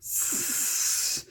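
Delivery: long drawn unvoiced hiss; long creature hiss. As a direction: hiss like a snake. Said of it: "said Gollum, more upset than ever".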